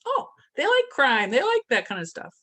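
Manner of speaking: high, loud mock computer voice; algorithm voice. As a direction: voice the algorithm thinking. Voicing Facebook's algorithm.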